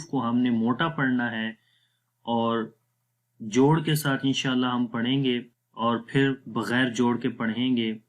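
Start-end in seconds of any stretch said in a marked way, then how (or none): none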